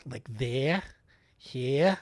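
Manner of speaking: ordinary talk, nothing marked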